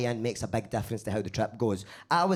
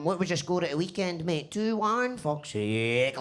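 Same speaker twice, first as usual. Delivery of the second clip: lightly put-on football chat voice; a hint of blokey pub banter; masking small talk; 3 semitones higher, slower than usual. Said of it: "I ended up going".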